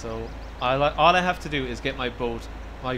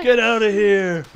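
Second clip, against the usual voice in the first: playful yell; 6 semitones higher, 10 dB louder, slower than usual. Pretend. Yelling at kayaker in game.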